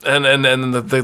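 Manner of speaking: ordinary delivery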